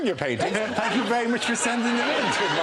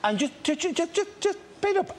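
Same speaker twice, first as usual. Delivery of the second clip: rising higher and higher; 12 semitones higher than usual. Pretend. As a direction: build pitch up with each "just". Mimics old lady painting.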